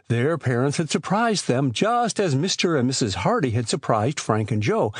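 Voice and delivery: ordinary delivery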